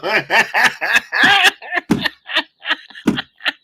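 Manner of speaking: long high helpless laugh; high laughing fit. Real, not acted. Streamer to chat, losing it in high laughter.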